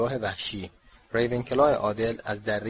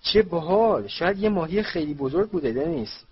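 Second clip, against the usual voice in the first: high, loud, thrilled exclamation; excited brother, settling at the end; 7 semitones higher, 4 dB louder than usual. A narrator's reading. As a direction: react with excited wonder, pitch up. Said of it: "هیجان زده شده بود".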